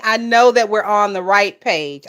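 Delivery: usual speaking voice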